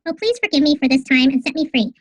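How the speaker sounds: high, fast, chipmunk-like playback; squeaky sped-up recording of a mouse. Shown as a demonstration. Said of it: "it'll sound like a mouse".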